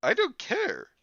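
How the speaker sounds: put-on high, loud bratty girl. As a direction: toss it off like a spoiled girl. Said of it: "she replied".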